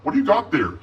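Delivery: put-on curious girl. A narrator's reading. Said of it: "she asked".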